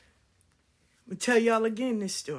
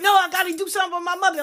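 much higher, loud, fast pleading; crying child plea; 10 semitones higher, 6 dB louder, much faster than usual. Retold or imitated. Her twelve-year-old self begging to be let go, high and frantic. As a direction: beg desperately through tears. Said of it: "Like, I'm crying".

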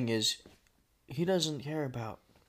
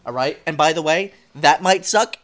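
loud, high angry complaining; rude customer; 4 semitones higher, 11 dB louder than usual. Pretend. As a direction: berate the waitress.

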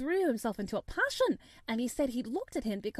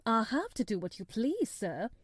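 ordinary delivery, nothing marked

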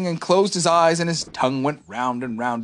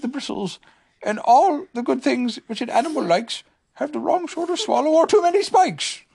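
Pooh's voice; 10 semitones higher than usual. Acted. Pooh musing to Piglet about Tigger, in a high bear voice.

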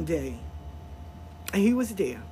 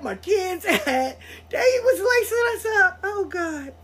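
laughing through tears; 7 semitones higher than usual. Real, not acted. Laugh-crying over the story.

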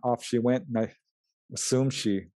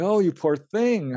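tender pity; 7 semitones higher, slower than usual. Retold or imitated. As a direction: melt with pity for a crying child. Comforting a crying murdered girl.

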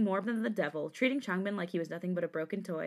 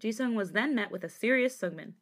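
ordinary delivery, nothing marked

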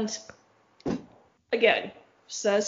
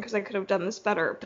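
ordinary talk

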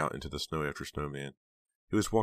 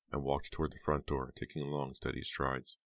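ordinary delivery, nothing marked

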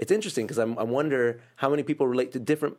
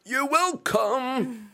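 The Rock impression; much slower than usual. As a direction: draw it out like The Rock. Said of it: "That was my Rock impression".

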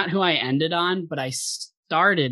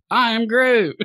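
higher, louder gruff Groot line as Tommy Lee Jones; 9 semitones higher, 5 dB louder than usual. Retold or imitated. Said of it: "Tommy Lee Jones".

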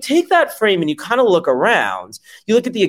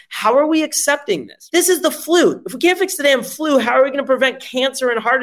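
indignant; 7 semitones higher than usual. Real, not acted. Ranting about flu not fixed.

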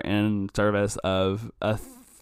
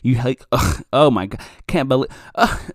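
loud outraged rant, louder throughout; furious friend; 5 dB louder than usual. Retold or imitated. Friend outraged that Dylan likes Magic, loud furious rant. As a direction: yell at him in disbelief. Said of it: "your friend is like".

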